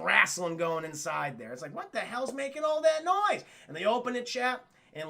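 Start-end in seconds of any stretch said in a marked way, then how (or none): 1.7-3.4 high-pitched mock bafflement; acted bewilderment. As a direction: exclaim it in raised pitch, playing startled. Acted startled reaction to noise.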